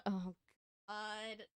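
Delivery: ordinary talk, nothing marked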